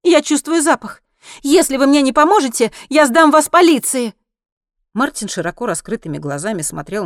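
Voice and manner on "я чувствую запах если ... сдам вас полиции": high, loud, forceful woman; stern, accusing insistence; firm threat. A narrator's reading. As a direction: threaten with a raised, determined voice. Woman threatens the driver with police.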